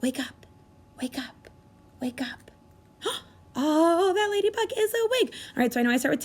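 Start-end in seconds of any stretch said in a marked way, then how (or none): 0.0-2.5 soft hushed whisper. Shown as a demonstration. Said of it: "Whisper it with me". 3.0-5.3 high, fast delighted gasp; excited gasp. Pretend. Ladybug wakes.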